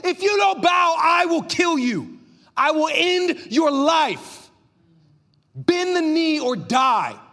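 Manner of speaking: high, loud, raging king's threat; furious rage. Acted. Enraged king threatens the three young men, furious shout. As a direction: thunder it slowly, every word an ultimatum. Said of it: "he was in furious rage".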